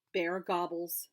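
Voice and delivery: plain reading voice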